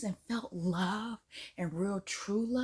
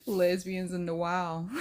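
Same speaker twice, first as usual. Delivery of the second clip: normal talking voice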